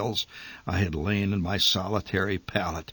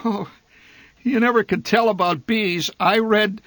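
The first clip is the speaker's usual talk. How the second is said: usual speaking voice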